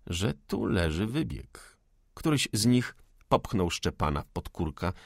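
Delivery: ordinary delivery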